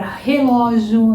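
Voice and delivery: relógio with an h-like R at the start; strong R sound. Shown as a demonstration. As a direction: begin relógio with an aspirated h for the R. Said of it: "Ele vai ter este som, que é um som mais forte".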